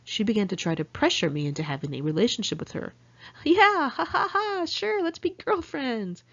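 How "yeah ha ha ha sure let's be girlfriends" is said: much higher, airy fake giggle, then slow; cutesy high-pitched deflection, drawn out at the end; light and cute. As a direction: brush it off with a high, girlish laugh. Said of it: "trying to keep it light and cute".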